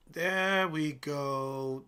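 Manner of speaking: very slow, stretched satisfaction; drawn out. Real, not acted. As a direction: stretch every word out. Watching a trade move.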